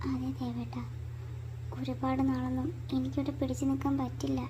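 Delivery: very soft, half-whispered admission; hushed, troubled. Acted. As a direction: confide it quietly to your husband. Troubled private plea to husband. (പതിഞ്ഞ, വിഷമം നിറഞ്ഞ സ്വരം)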